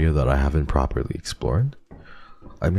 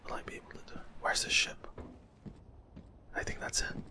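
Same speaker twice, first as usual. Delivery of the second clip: tense unvoiced whisper; 18 dB quieter, faster than usual. Real, not acted. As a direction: whisper it like monsters are near. Repeats question in a whisper.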